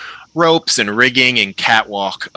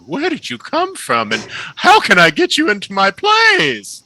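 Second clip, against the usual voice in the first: flamboyant halfling; 11 semitones higher than usual. Acted. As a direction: gush like a delighted stage impresario. GM voices the halfling Mallow.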